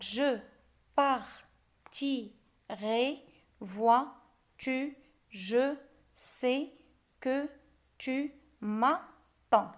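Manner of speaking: "je partirai, vois-tu, je sais que tu m'attends" chopped into slow separate syllables; scanning "je partirai, vois-tu, je sais que tu m'attends" slowly, one syllable at a time. Shown as a demonstration. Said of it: "On fait de même".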